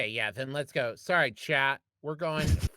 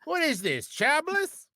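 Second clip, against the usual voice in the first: tipsy wine mom; 13 semitones higher, 7 dB louder than usual. Acted. Wine mom bit continues.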